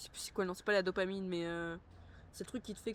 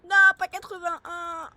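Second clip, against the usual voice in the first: high, loud, slow outcry of protest; exasperated shout; 10 semitones higher, 15 dB louder, slower than usual. Real, not acted. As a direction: yell at the bad price. Shouting at game price.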